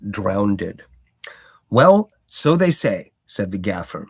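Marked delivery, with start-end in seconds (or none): 1.7-3.1 put-on louder, slow knowing old gardener; Gaffer's voice. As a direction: draw it out like a village sage.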